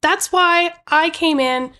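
high, slow, affronted voice; Aunt Gertie. Acted. Aunt justifying her arrival to the nephew, affronted. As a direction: explain it indignantly, drawing the words out. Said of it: "she starts to say".

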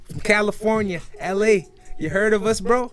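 high-pitched, put-on American cool-guy voice; acting cool. Young poser telling schoolmates he's from America, acting cool. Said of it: "so I act cool. I'd be like".

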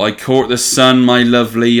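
hammed-up West Country accent; Cornish accent. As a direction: play the proud local, Cornish accent. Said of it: "I'm like a proper Cornishman".

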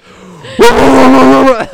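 very loud, high barking noise; imitated dog bark. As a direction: blast out a dog's bark. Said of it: "he was just like".

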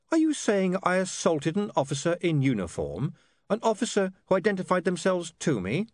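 put-on high-pitched, haughty young man; indignant witness. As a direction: challenge the accusation with precise indignation.

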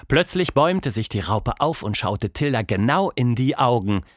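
plain reading voice

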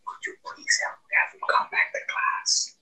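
hushed, voiceless plea, mimicking a helpless teacher; imitated pleading whisper. New teacher asking a colleague for help, whispered plea. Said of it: "she's trying to figure out what to do".

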